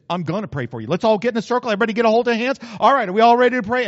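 loud, brisk rallying call; rousing prayer leader. Retold or imitated. Praying landlord calling folks into a circle, loud rallying. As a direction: gather everyone up at full volume. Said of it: "She said".

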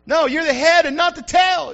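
a loud preacher's rebuke; shouted correction. Real, not acted. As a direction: shout it back at them.

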